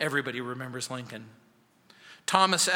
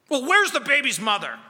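much higher, loud barking demand; barked question; 9 semitones higher, 10 dB louder than usual. Acted. Stern passenger barks at the grieving woman, loud and harsh. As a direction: bark it at her like a gruff stranger. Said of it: "The man barked".

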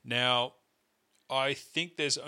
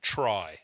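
lower, louder "try." leaned on hard; stress on try; 3 semitones lower, 3 dB louder than usual. Shown as a demonstration. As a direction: hit "try." firmly, on its own. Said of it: "I emphasis on the word try".

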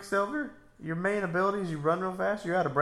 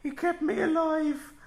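high, slow, louder creepy widow voice; creepy widow impression; 14 semitones higher, 6 dB louder, slower than usual. Imitating the Walking Dead widow, creepy and high. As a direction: play the widow who kept her husband's head. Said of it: "That's a good impersonation".

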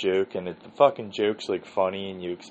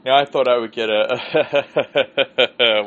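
laughing; 4 semitones higher, 7 dB louder, much slower than usual. Real, not acted.

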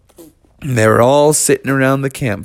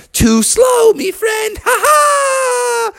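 put-on taunting kid bear; much slower than usual. Junior taunts Bubby.

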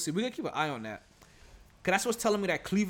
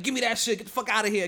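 half-yelled trash talk, higher and louder; imitated yelling; 5 semitones higher, 4 dB louder, faster than usual. Imitating Melo yelling for a rebound, mildly shouted. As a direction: bark it a bit like a player grabbing rebounds. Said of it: "yelling out some obscene shit".

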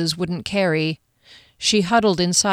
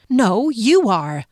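slow, higher, emphatic contradiction; mildly angry, deliberate comeback; argumentative retort; 4 semitones higher, 4 dB louder, slower than usual. Acted. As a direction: turn the accusation straight back. Heroine retorts in a quarrel.

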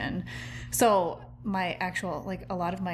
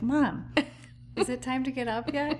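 lightly imitated child, raised pitch; a hint of a child's high pleading; little boy asking mom; 5 semitones higher than usual. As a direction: play the kid asking yet another time, amused. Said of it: "he'll come out and he's like".

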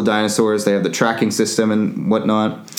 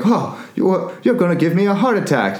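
startled scolding; 8 semitones higher than usual. Retold or imitated. Startled maid scolds the child.

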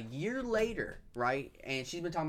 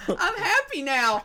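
high, slow, creepy mock-happy child voice; forced happy crying child; 14 semitones higher, 8 dB louder, slower than usual. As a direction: imitate an upset kid faking cheer, high and loud. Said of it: "put on a smiling face as she's still upset crying".

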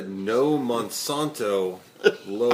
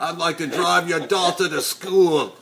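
Jason Statham; 7 semitones higher, 7 dB louder than usual. Retold or imitated. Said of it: "This is Jason Statham".

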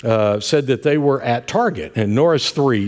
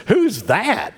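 imitating mom; 8 semitones higher than usual. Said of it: "Mom says".

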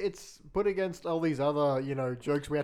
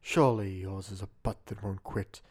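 sexy voice; 7 semitones lower than usual. Shown as a demonstration. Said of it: "give it to us in your sexy voice".

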